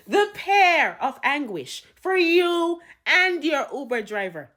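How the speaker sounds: high, loud, slow proclamation; stretched, booming mock verdict; sentencing judge. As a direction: draw out the punishment with grand menace.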